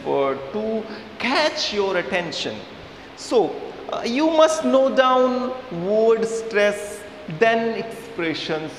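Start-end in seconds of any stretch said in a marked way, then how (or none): none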